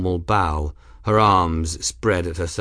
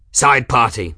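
loud gruff barked order; snarled order; 6 dB louder than usual. A narrator's reading. Kettle ordering the side party, loud and snarling. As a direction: snarl the command at the crew. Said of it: "Kettle snarled".

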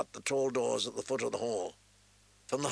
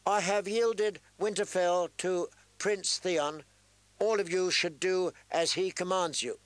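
raised voice; 7 semitones higher than usual. A narrator's reading. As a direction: announce it to the whole hall. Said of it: "He raised his voice".

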